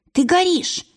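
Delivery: high, urgent alarm; frantic high call; alarmed cry. A narrator's reading. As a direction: shout the warning at him. Varvara warns sleeping man.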